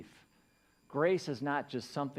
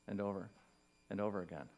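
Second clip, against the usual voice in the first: ordinary talk, nothing marked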